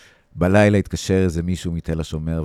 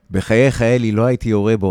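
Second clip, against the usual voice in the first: loud pleading; 7 semitones higher, 10 dB louder than usual. Acted. Soldier pleading with sergeant Eli.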